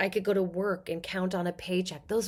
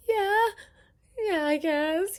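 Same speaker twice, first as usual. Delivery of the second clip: unsure agreement; 11 semitones higher, slower than usual. Retold or imitated. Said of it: "I'm like".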